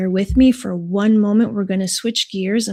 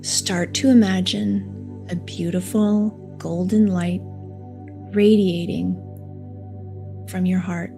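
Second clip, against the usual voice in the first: soft, slow meditation voice; guided meditation; 7 dB quieter than usual. Acted. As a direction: guide them gently into calm, unhurried. Leads a heart meditation.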